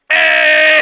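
loud shouted greeting; impression of Kiara. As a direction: burst in yelling hey. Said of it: "the impression of the decade".

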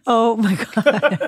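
slow, laughed-out exclamation; laughing. Real, not acted. Co-host reacting to a friend's dirty joke, laughing. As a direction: draw it out through real laughter.

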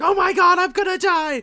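high, loud mock panic; panicked scream. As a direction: shriek like you think you're dying, hammed up. Retelling Okuyasu freaking out.

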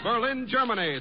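ordinary talk

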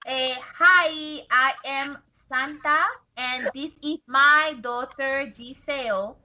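put-on careful, broken-English immigrant parent accent. Mom introducing her daughter to officials, careful immigrant accent. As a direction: put on a mother's immigrant accent, trying to sound proper. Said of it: "sounds like how our parents talk".

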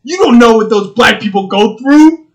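hammed-up preachy sympathy; mock woke person. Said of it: "they're gonna be like".

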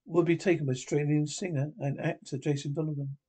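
ordinary talk, nothing marked

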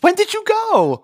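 very high, eager question; excited. Real, not acted. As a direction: squeal the question, dying to know. Eagerly asks about the taping.

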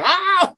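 high-pitched "ah!" of sudden pain; comic high-pitched cry; mock yelp of pain. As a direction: yelp as if suddenly hurt, played for laughs. Acting out a mishap during sex.

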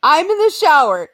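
ordinary talk

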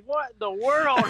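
high, slow, laughing disbelief; drawn-out comic dismay through laughter; mock bewilderment. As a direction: act out a baffled husband. Bewildered husband voiced for laughs.

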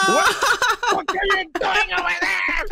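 laughing shout. Real, not acted. Teasing friend about airport.

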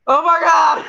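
high, very loud shocked scream; hammed-up shocked scream; mock shriek. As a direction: shriek in fake horror. Acted shock walking in door.

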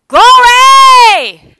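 a high, loud, stretched glory shout; ecstatic glory cry. Pretend. Pastor mimicking churchgoers cheering at good news. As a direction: burst out in joy. Mocking how believers react.

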